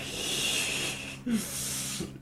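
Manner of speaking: long voiceless laugh at own mistake; wheezy amused laughter; helpless breathy laugh. Real, not acted. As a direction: laugh softly, mostly on breath.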